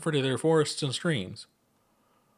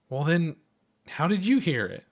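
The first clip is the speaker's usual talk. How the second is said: high flustered question; 7 semitones higher than usual. Acted. Chuck asks, high pitch.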